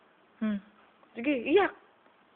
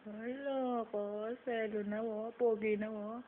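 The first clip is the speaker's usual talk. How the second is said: slow, softer cooing; tender cooing; 9 dB quieter, slower than usual. Real, not acted. Cooing baby softly. (malambing na pag-amo)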